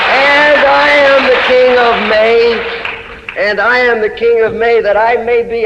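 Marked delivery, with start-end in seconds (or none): 0.1-3.4 high, slow, drawn-out declaration; lifted, slowed chant of the title line; slow proclaiming refrain. Acted. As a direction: stretch the refrain out like a royal proclamation.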